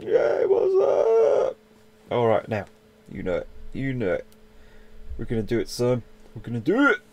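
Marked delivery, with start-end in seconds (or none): 0.0-1.6 high, slow squeaky shout. Pretend. Squeaky shout greeting the stranger again. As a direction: stretch the greeting out, squeaky and loud. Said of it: "my voice is getting a bit squeaky there".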